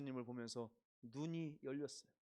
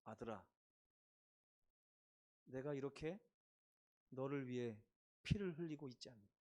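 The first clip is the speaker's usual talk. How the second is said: lower, soft and tender; Jesus' tender voice; 3 semitones lower, 3 dB quieter than usual. Acted. Jesus speaking to the thief, tender soft. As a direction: speak gently as a loving father. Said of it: "다른 음성이 들려오는".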